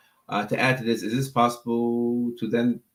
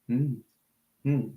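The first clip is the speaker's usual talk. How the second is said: usual speaking voice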